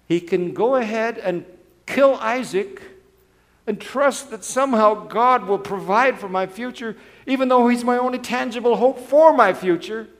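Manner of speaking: rising, loud pulpit fervor; loud, high, building sermon climax; impassioned preaching. Acted. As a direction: build the pressure with every phrase. Preaching at full force.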